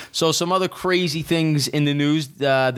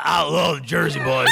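imitating a hoarse, cracked voice; raspy whisper imitation. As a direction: mimic the wife's lost voice with a laugh. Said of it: "And they just got".

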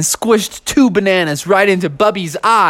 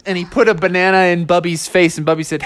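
ordinary delivery

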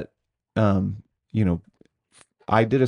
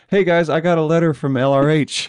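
mock casual announcement; 6 semitones higher, 5 dB louder than usual.

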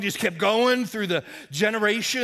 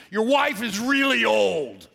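slow, drawn-out parrot taunt; parrot heckle; 2 semitones higher, slower than usual. Acted. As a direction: stretch the insult like a parrot calling out. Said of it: "the parrot yelled out".

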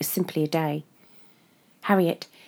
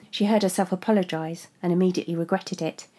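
plain reading voice

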